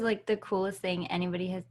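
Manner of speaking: ordinary talk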